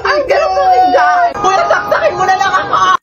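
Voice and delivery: high panicked crying screams; hyperventilating. Pretend. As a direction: gasp and shriek in panic. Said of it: "Nag-hyperventilate na ako".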